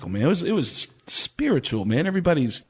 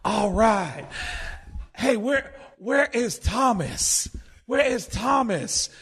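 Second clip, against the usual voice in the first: high, slow, drawn-out stoner call for Thomas; imitating Mitch; 9 semitones higher, slower than usual. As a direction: lazily call out to the back of the room. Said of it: "Mitch gets up".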